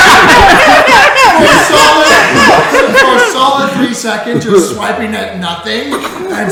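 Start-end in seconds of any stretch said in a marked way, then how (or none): 0.1-3.2 loud, high words through real laughter; laughing too hard. Real, not acted. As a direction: struggle to talk through a laughing fit. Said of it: "I was laughing too hard".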